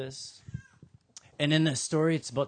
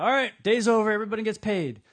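raised, higher call to a work crew; 7 semitones higher, 6 dB louder, faster than usual. Acted. Landowner calls workers in for pay, calling out. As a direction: announce the end of shift loudly.

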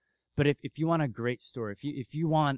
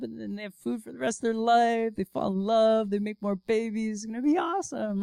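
dreamy fairy-tale ending; 7 semitones higher than usual. Retold or imitated. Mocking fairy-tale happy ending.

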